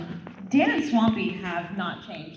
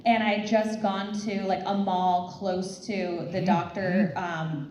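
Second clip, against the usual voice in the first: ordinary talk, nothing marked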